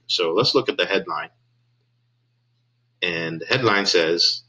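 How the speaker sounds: ordinary delivery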